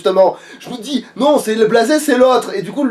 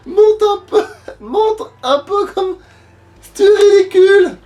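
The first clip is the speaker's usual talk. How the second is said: witch voice; 8 semitones higher than usual. Acted. Witch voice kept in play.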